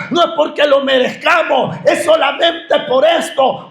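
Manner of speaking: impassioned sermon delivery; full-force preaching. Acted. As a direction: proclaim it to the whole church. Preacher building at the pulpit.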